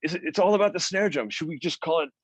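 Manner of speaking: a playful imitation of Ronnie chewing out Simon; mock cussing out. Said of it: "he'd cuss him out".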